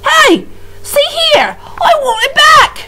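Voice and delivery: high, loud shouting protest; outraged shout. A narrator's reading. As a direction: yell after the thief. Prisoner loses tablecloth.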